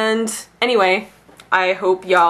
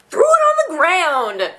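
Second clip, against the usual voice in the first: high, drawn-out mock yell; Andy Samberg; 8 semitones higher, 3 dB louder, slower than usual. Describing the kid hurling a puzzle, comic mock yell. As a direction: stretch it out like a comedy-sketch outburst. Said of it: "like Andy Samberg, S N L".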